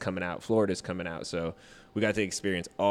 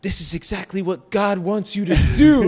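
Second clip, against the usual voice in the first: much higher, much louder insistent shout; mock shout; 10 semitones higher, 10 dB louder than usual. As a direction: convince him by yelling. Dude shouting to convince him.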